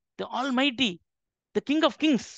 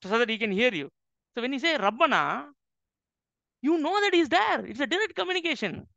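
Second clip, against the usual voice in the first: normal talking voice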